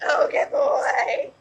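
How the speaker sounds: loud, high, drawn-out dog praise; whooping, stretched-out praise to win a dog's attention; super excited praise. Shown as a demonstration. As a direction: go over the top praising the dog. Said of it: "be super exciting".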